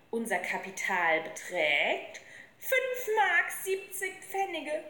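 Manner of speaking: slow, drawn-out proclamation rising high; joyful announcement. A narrator's reading. Little boy announcing the gang's money, joyful proclamation. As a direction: announce the total with excited ceremony. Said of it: "Er sprang vor Freude".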